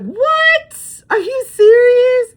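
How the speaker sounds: high, loud, slow incredulous what; shocked disbelief. Retold or imitated. Telling coworkers, loud high shocked disbelief. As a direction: squeal of disbelief, stretched out.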